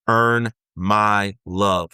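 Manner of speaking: each word slowly drawn out; slow quoted demand. Retold or imitated. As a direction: spell out the demand slowly and heavily. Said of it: "was the message that you were given".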